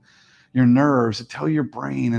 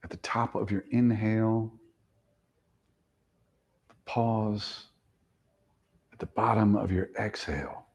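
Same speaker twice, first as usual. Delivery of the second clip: guided meditation; 3 semitones lower than usual. Acted. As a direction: lull them into the count. Guides breath counting.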